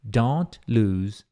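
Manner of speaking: drawn-out word by word; slow careful read; very slow model. Shown as a demonstration. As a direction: dictate it very slowly. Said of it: "e um Z no final".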